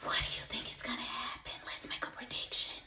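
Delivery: whispered, fast and hushed; suspense whisper. Shown as a demonstration. Teacher hushing the class before the twist, whispered. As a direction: lean in and whisper the question.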